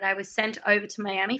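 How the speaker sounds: ordinary talk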